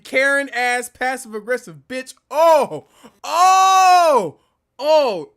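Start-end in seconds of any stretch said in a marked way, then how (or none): 2.3-5.3 loud, high, anguished oh oh oh; exasperated groan. Real, not acted. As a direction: wail at the insult. Exasperated at passive aggression.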